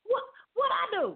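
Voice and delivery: high alarmed repeated wait. Retold or imitated. To her friend with a knife, frantic high pleading.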